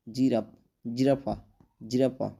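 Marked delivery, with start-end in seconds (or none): none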